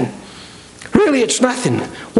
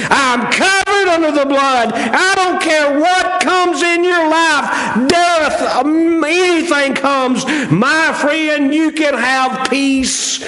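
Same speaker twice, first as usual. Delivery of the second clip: preaching fervour; 6 semitones higher, slower than usual. Real, not acted.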